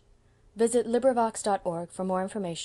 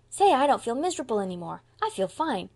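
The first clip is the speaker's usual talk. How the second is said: put-on bouncy, raised little-boy voice; relieved child; 4 semitones higher, 5 dB louder than usual. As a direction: announce it brightly as a relieved small boy. Forgiven child cheers up.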